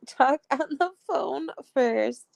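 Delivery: slow, high retake through giggles; laughing self-correction. Real, not acted. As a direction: laugh at your slip, then say it slowly. Laughs after stumbling over words.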